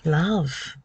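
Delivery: ordinary talk, nothing marked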